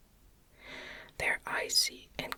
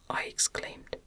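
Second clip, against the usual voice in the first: ordinary delivery, nothing marked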